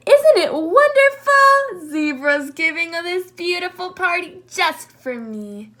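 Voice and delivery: thrilled, voice soaring; delighted. Acted. Moose delighting in his birthday party, high and gushing.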